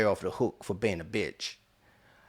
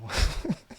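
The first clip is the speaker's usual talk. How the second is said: breathy laugh; 7 semitones higher than usual. Real, not acted. Laughs at own foolishness.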